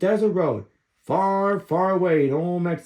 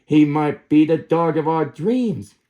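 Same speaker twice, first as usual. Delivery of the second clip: usual speaking voice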